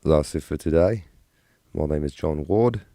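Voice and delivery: lightly put-on British-Australian host voice; a hint of an Aussie-British TV presenter; British-Australian accent. Shown as a demonstration. Streamer rehearsing an outro in a British-Australian accent. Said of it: "British and Australian all at once".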